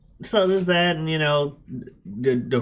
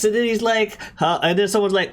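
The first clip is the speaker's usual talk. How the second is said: ordinary delivery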